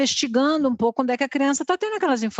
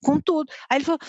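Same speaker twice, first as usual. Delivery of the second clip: ordinary delivery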